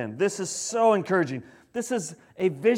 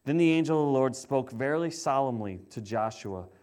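quieter, measured reading of the Bible passage; scripture reading; 4 semitones lower, 3 dB quieter than usual. Acted. Pastor reads Zechariah to the congregation, quiet and measured.

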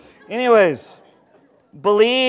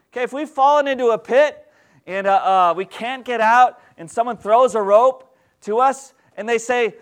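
normal talking voice

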